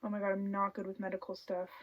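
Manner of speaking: ordinary delivery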